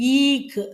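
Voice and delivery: ஈக்கு with long high ஈ and short k; model pronunciation. Shown as a demonstration. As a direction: stretch the ஈ, then close on a quick க். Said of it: "இப்ப சொன்னதுதான்".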